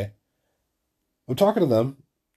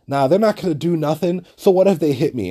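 higher, louder mock defiance; mimicked cocky bravado; 5 semitones higher, 4 dB louder than usual. Short chick brushing off danger, mimicked cocky bravado. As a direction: imitate someone small acting tough. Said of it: "fucking Napoleon complex chick is like".

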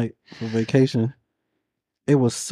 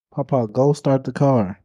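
ordinary talk, nothing marked

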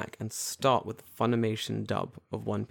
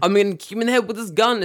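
high, loud acted threat; hammed-up gunman line, pitched up; mock threat; 10 semitones higher, 7 dB louder than usual. Imitating the badly censored gun scene, mock threat. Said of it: "And it's like".

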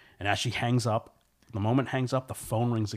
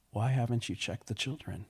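lightly voiced sinister caller; measured, faintly menacing question; creepy caller; 3 semitones higher than usual. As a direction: put on a hint of the creepy phone caller. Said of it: "and this time the caller says".

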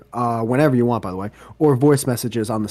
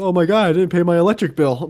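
mock panic; 7 semitones higher, 6 dB louder than usual. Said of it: "you found out five minutes before the podcast".

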